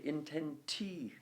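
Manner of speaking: inten-TI-que, demonstrating the stress; stress on third syllable. Shown as a demonstration. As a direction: hold the "ti" of "intentique", drop off on "que". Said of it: "You're putting the stress on the intenti".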